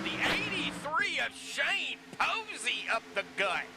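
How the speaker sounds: sharp rise in pitch, calling the move; excited call. Real, not acted. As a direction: call the pass with a jolt of excitement. Three wide racing play-by-play.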